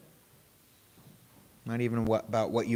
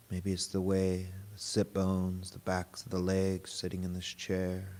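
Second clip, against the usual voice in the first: guided meditation; 3 semitones lower, 3 dB quieter than usual. Real, not acted. Teacher guiding a group to notice body and breath, guided meditation. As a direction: lead them gently inward, unhurried and even.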